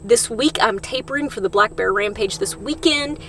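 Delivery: ordinary delivery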